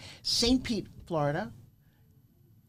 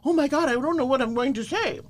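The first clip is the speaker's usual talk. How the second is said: higher, hammed-up nervous gabble; mock panic; 6 semitones higher, 3 dB louder than usual. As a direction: imitate a jittery speaker. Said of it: "there's not a single nerve".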